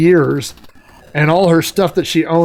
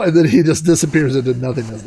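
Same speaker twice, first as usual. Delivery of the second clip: ordinary talk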